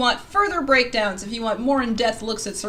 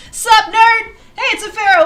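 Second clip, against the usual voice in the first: high, loud playful hail; excited greeting; 12 semitones higher, 11 dB louder than usual. Real, not acted. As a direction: shout a teasing hello to a viewer. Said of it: "I might be a little excited".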